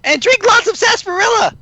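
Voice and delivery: very high cheery Pinkie voice; squeaky excited Pinkie Pie voice; high character voice. Acted.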